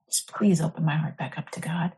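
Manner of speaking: a hushed, breathy whisper of the prayer, under her breath; whispered plea. Retold or imitated. Retelling her prayer to the saint, she whispers the plea. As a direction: whisper the plea as if only God should hear.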